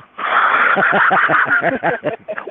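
long burst of high laughter. Real, not acted. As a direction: laugh hard at being told you have an accent. Amused at talk of her accent.

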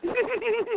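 very high, rippling giggle; imitated giggly laugh. Showing how his actress friend laughed, high giggle. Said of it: "it was very giggly, like that".

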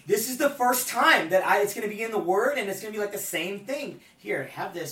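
a high, loud, laughing mock-divine announcement; giddy God voice. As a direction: play God delighted with his own joke. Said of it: "So he was like".

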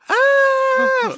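loud, high, held scream of pain; re-enacted scream. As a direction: scream as if the pepper just hit. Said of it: "I fucking start screaming".

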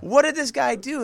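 high, loud stunned squeal; mock astonishment. Cop reacting to the lawsuit, squealed mock shock. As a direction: break off in high-pitched disbelief. Cops learn of the huge suit.

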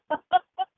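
short high giggle; light laugh. Real, not acted. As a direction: laugh at your own joke. Joking about needing Botox shots.

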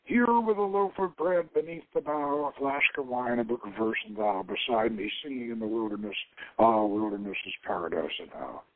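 lightly voiced verse recital; poem recital. Shown as a demonstration. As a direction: recite it like a cherished old poem. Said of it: "We actually memorized the Rubaiyat".

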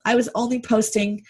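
ordinary delivery

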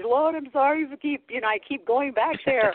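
high, rushed-up apology to God; retold sheepish apology; apologetic. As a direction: plead sheepishly, pitch lifted well up. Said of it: "I would almost apologize".

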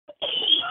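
short unvoiced cough; one quick cough mid-sentence. Real, not acted. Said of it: "Excuse me, one more time".

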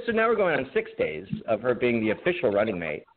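usual speaking voice

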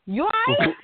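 high, worried question; lightly voiced alarmed server; concerned server. Server Frank asking if she is okay, worried.

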